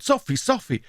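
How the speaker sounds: high-pitched cry at narrator's volume; restrained, high narrated scream; mock scream. A narrator's reading. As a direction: cry the name out high, without real force. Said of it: "and screaming out".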